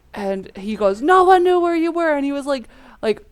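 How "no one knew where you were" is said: upset dad. Retold or imitated. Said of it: "on the verge of tears".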